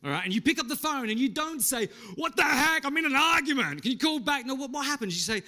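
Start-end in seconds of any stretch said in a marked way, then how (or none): 2.2-4.5 high, loud angry outburst; exasperated, raised-voice rant; thunder and lightning. Acted. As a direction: blow up at the caller mid-argument. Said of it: "you've just gone from thunder and lightning".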